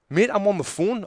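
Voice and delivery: loud, high-pitched protest; retold anger. Passenger telling driver he's on the phone, angry. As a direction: plead your case indignantly, loud and high. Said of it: "I'm getting angry".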